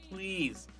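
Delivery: ordinary talk, nothing marked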